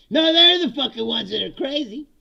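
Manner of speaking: high, loud incredulous outburst; indignant retort. Retold or imitated. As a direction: throw the accusation back, loudly. Indignant retort about the crowd.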